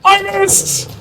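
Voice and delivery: tense, breath held tight; holding breath. Real, not acted. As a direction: squeeze words out holding breath. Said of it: "I'm holding my breath".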